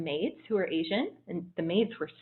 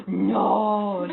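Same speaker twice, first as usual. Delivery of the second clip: shocked no; 3 semitones higher, 7 dB louder than usual. Retold or imitated. Waiters learning her real age, loud shocked cry. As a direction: gasp it out in disbelief.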